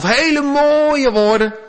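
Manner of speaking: high, slow, swooning mock grandeur; mock-impressed pagan worshipper; mock heathen praying. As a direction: draw the words out high, hammed-up. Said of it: "Dat was natuurlijk het heidense bidden".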